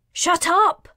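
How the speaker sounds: high, full-force shout. A narrator's reading. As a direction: cut them off with a shout. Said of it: "Karina shouted".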